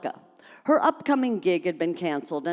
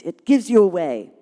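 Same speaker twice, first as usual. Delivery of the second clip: hissed; 4 dB louder than usual. Acted. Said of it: "Angela hissed".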